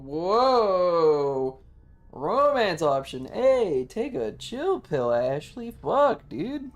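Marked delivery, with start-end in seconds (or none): none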